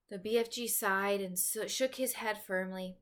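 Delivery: usual speaking voice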